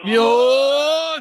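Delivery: high, loud celebratory "Yun!"; cheering shout. Real, not acted. Host celebrates the right answer, cheering shout.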